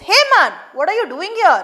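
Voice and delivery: quick, sharp demand; fast challenge. Retold or imitated. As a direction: catch him out. Watchman confronts the fisherman.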